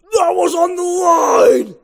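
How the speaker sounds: slow, high, furious yell that it was in; angry shout. Acted. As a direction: shout the protest, dragging it out. Ken protests a line call.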